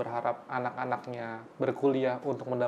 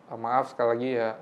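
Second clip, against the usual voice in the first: usual speaking voice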